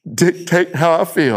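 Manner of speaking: put-on slow, halting reluctant child; lightly voiced boy repeating dad's words; imitating young son. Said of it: "He says".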